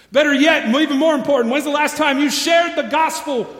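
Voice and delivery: loud, high, driving sermon climax; preaching at full force. Acted. Pastor urging the church to save their families, loud preaching. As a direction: preach it hard, pleading with the church.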